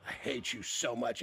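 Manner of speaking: low, slow, hushed mock hatred; quiet, deep, deliberate grudge, played for laughs; retold bitter muttering. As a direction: mutter it slowly under your breath. Retold muttering at a broken device.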